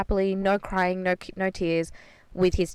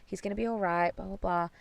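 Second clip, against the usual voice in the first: hushed, much softer mimicked comforting; half-present reassurance; 2 semitones higher, 10 dB quieter than usual. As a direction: murmur it as if only half in the conversation. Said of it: "not being super in the conversation".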